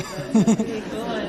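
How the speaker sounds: loud laughter through the words; laughing. Real, not acted. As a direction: laugh while saying it. Laughs at the college name.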